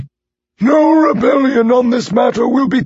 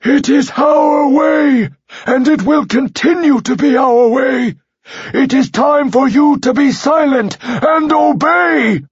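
shrill shout; 4 semitones higher than usual. Acted. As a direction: roar the command in fury. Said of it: "His voice raised in a shrill shout".

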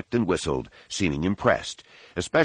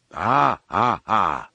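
put-on a Wookiee snapping back at the boy; Wookiee growl; much slower than usual. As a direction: roar it back like a cross Wookiee. Wookiee answers Jason angrily.

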